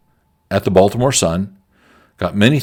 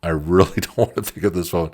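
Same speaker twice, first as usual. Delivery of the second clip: laughing dread; 2 semitones lower than usual. Retold or imitated. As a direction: repeat it laughing through the words. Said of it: "I'm looking at the phone like".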